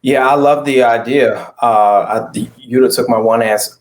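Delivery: usual speaking voice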